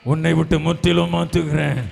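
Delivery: loud, driving proclamation on a level pitch; forceful declaration. Real, not acted. Preacher repeats God's promise, forceful. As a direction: hammer the promise out loudly.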